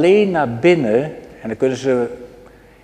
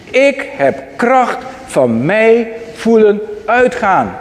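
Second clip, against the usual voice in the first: "Ik heb kracht van mij voelen uitgaan" drawn out slowly and raised in pitch; slow, higher preaching emphasis; 6 semitones higher, slower than usual. Shown as a demonstration. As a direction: preach each word of it slowly, lifted high. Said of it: "Want ik heb kracht van mij voelen uitgaan".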